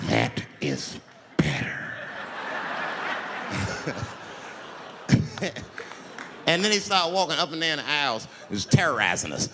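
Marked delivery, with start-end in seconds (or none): 0.1-1.9 very low, drawn-out approval; creepy low voice. Acted. Same man, now sinister. 2.0-5.9 long, mostly voiceless chuckle; low creepy laugh. Acted. Acted laugh of the crazed man.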